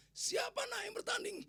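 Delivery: high, stretched-out call. Retold or imitated. Preacher asking the children who is playing, calling out high.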